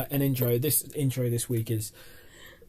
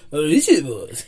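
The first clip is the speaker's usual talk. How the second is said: put-on Chinese accent; 2 semitones higher, 10 dB louder, much slower than usual. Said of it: "I said it in a Chinese accent".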